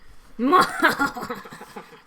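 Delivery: loud villain laugh trailing away; evil laugh. Acted. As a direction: gloat with a mock-evil cackle. Villain laugh after "deed is done.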